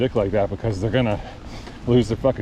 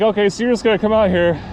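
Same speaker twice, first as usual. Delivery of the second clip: indignant retort; 5 semitones higher than usual. Retold or imitated. Retelling his argument with driver.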